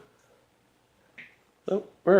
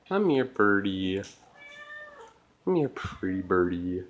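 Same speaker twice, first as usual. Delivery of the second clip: coaxing a wild bird; 9 semitones lower, 11 dB quieter than usual. Real, not acted.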